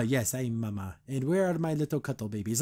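low, soft mock creature accent; weird Bat Queen accent. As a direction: imitate an odd, low-pitched foreign-sounding accent. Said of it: "has, like, this really weird accent".

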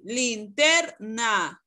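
"linterna" slowly broken into three syllables. Shown as a demonstration. Teacher sounding out "linterna" for Astrid, syllable by syllable. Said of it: "¿Cuál de las tres sílabas suena más fuerte?".

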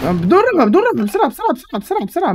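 high, frantic hurry-up call; urgent excited shout. Real, not acted. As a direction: rush them, voice climbing high. Urging teammate in a fight. (صراخ مستعجل متحمس)

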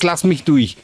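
loud, urgent plea. Real, not acted. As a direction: beg loudly to get through. Same words then whispered.

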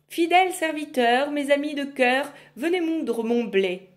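loud incantatory summons; summoning call. Acted. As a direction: bellow for the servants. Witch summons servants.

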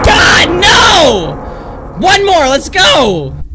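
screaming loud and high in triumph; excited screaming. Real, not acted. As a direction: yell the win at the monster. Near miss, then win.

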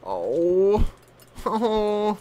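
ordinary talk, nothing marked

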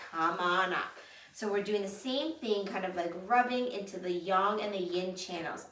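ordinary delivery

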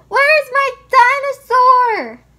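very loud, high angry shout; upset yell. Acted. Child who lost a favorite toy, upset yelling. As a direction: yell it high, at full force.

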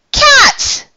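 very high, loud yelp; shocked cry. A narrator's reading. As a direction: cry out in alarm at a surprise. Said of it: "he cried".

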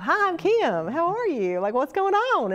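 high-pitched, perky Oklahoma twang; friendly Oklahoman accent. Retold or imitated. Newcomer introducing herself to everybody, chirpy Oklahoma accent. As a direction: play up a very friendly Oklahoma hello. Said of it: "they did not expect this to come out of my mouth".

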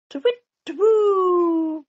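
high, loud twit-twoo; owl hoot. A narrator's reading. Owl in the night garden, hooting high and loud. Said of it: "went the owl".

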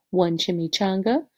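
ordinary delivery

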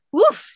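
sudden loud, high "woof" of breath; exhaled oof. A narrator's reading. Heroine careens into a solid wall of muscle, startled exhaled oof. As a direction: let the air burst out as if bumped.

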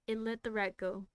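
ordinary delivery, nothing marked

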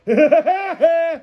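high, rapid staccato cartoon cackle; Woody Woodpecker laugh. Host imitating a cartoon bird he cannot place, manic laugh. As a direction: cackle in repeated high bursts. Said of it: "Woody Woodpecker".

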